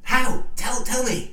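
high, loud, desperate; urgent plea. Acted. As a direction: beg for the answer.

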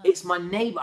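high, loud retold amazement; astonished disbelief. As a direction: hammer home the unbelievable news. Repeats the shocked discovery.

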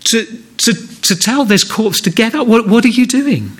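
acted disbelief, scolding someone for interrupting; indignant onlookers. Mourners challenging a man interrupting the funeral, indignant. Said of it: "imagine the people around saying".

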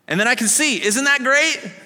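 overflowing with good news; thrilled healed man. Retold or imitated. Healed man telling neighbors how he sees, thrilled. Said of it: "The man they call Jesus".

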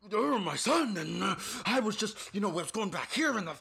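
high, loud mock rambling of a wild stranger; imitating ranting man. Friend imitates the crazed stranger from the train, loud high rant. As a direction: act out an unhinged man's loud, high babble. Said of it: "I'm just gonna fill something in".

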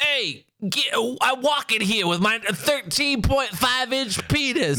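high, slow, hammed-up Brooklyn accent; bad Brooklyn accent. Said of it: "how would he sound in a Brooklyn accent".

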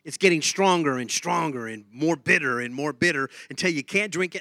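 normal talking voice

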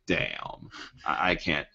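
low, soft panicky mutter; panicked. Retold or imitated. Miles panicking over his powers, soft low dread. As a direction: hush it like panic is setting in. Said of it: "freaks out and has a panic attack".

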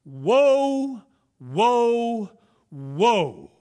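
a loud high cry of woe, falling on the last; loud proclamation. Acted. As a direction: call it out like a herald in midair. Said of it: "it says with a loud voice".

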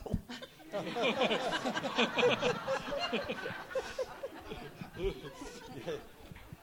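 big rising laugh; hearty laugh; long laugh. Real, not acted. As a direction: laugh out loud at the memory. Laughs after story.

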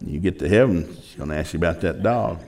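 low, hushed divine reminder; voice of God. Retold or imitated. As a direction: say it low and soft, as God's message. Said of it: "but it was God".

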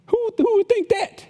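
high, disbelieving question; high mock-baffled question; incredulous. Real, not acted. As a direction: pitch it way up in disbelief. Pastor mocks a crazy accusation.

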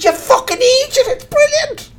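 high-pitched, loud imitation of scolding friends; mock exasperated yell. Friends retort on the phone, retold.

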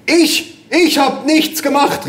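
very high, drawn-out protest; indignant cry. A narrator's reading. Mother denying she broke the laptop, crying out. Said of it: "rief meine Mutter".

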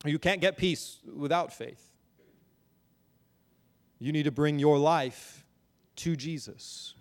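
ordinary delivery

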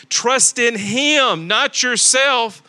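slow, drawn-out "Trust in him, not yourself"; stress on him. Shown as a demonstration. Preacher slowing down to stress the verse. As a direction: lean on "him" and "yourself", slowly. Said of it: "Notice what it says".

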